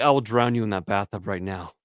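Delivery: low-pitched retold threat; mock threat. Retold threat to his wife.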